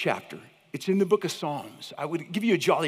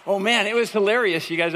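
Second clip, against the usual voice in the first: normal talking voice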